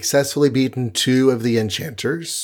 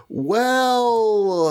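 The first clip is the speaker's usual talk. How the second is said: mock dismay; 9 semitones higher than usual. Thor reacts to his father.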